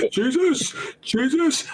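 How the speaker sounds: high pleading. Retold or imitated. Voiced Jesus calling out.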